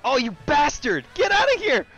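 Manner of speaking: cracking, outraged shout; furious yelling. Real, not acted. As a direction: scream at the player who hit you. Knocked off course in race.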